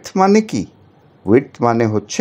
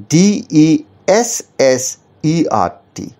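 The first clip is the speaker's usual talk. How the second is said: ডিইএসএসইআরটি spelled very slowly and a bit higher, the added এস drawn out; slow spelling with double S; 3 semitones higher, much slower than usual. Shown as a demonstration. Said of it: "এই শব্দটার মানে কিন্তু আর মরুভূমি নয়".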